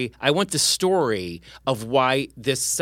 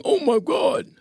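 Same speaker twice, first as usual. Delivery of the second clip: ghost voice; 13 semitones higher, slower than usual. Retold or imitated. Quoting the ghost recording.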